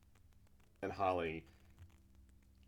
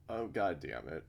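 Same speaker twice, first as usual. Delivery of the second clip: ordinary delivery, nothing marked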